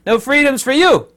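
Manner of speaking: a high, loud taunting decree; mocking president. Mocking the New Zealand president denying freedoms, taunting. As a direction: taunt like a gleeful tyrant. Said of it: "president of New Zealand saying that flat out".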